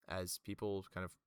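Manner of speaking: ordinary talk, nothing marked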